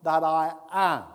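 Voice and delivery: slow, loud, solemn divine voice; voice of God. Acted. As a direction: proclaim each word slowly like God from the bush. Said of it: "God replies".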